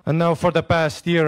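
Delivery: ordinary delivery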